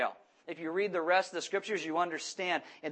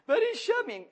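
high-pitched mock child whine; hammed-up tearful kid squeal; screaming and crying; 3 dB louder than usual. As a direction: protest like a wailing little boy. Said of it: "he's screaming and crying".

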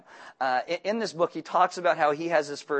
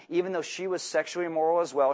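usual speaking voice

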